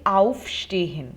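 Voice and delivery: AUFstehen with the first syllable "auf" punched; stress on the prefix. Shown as a demonstration. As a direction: hit AUF in aufstehen, let stehen drop. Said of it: "the intonation or emphasis is on the prefix".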